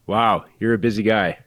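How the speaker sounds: ordinary delivery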